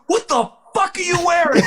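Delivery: very high, appalled yell; imitated commander's baffled outburst; incredulous going off. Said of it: "started going off on him".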